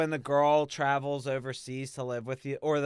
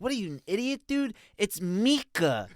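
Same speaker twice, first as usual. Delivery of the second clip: bratty little brother; 6 semitones higher than usual. Retold or imitated. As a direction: talk like a six-year-old mocking. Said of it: "six years old".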